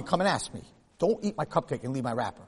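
ordinary talk

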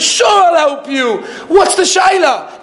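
high, slow, expansive reassurance; generous rich man. Retold or imitated.

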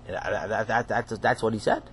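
soft unsure stammering; timid stammer. Acted. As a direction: mumble it shyly.

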